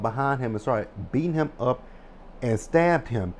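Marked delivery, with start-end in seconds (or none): none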